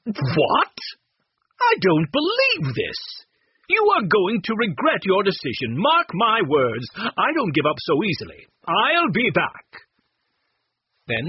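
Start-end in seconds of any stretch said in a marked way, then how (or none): 0.1-9.7 high, loud, indignant villain's threat; indignant Mr. Chessy. A narrator's reading. Thwarted buyer threatening the family, loud and indignant. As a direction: storm off with a warning.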